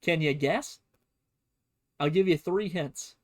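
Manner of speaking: normal talking voice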